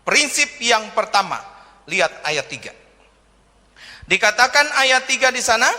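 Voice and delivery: usual speaking voice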